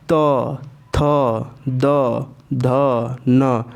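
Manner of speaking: त, थ, द, ध, न with the tongue pressed on the teeth; demonstrating dental sounds. Shown as a demonstration. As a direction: dental stops, one by one. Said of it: "दांत पे जोर पड़ रहा है". (दंत्य उच्चारण)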